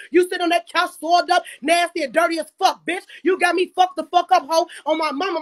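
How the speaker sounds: ordinary delivery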